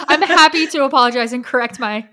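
high, loud, laughing through the words. Real, not acted. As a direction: blurt it out while cracking up. Flustered, laughing over a term check.

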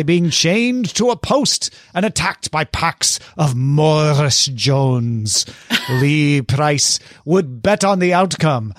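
slow mock Shakespearean accent; hammy stage actor. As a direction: drag it out like an old stage actor. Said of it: "we're going accent".

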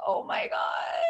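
breathless, high mock-horrified cry; horrified gasp. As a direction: gasp it out in dismay. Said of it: "And I'm like".

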